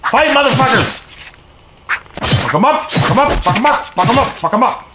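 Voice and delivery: high-pitched rallying shouts; urging battle cry. Real, not acted. Player urges his little dudes into a fight, excited yelling. Egging on game minions.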